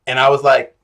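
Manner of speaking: ordinary talk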